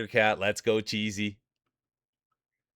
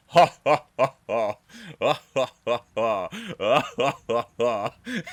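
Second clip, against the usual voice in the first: loud, long, ridiculous fake laugh; imitated contagious laugh; 3 semitones higher, 6 dB louder than usual. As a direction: imitate the coworker's silly laughing fit. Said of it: "she just started going like this".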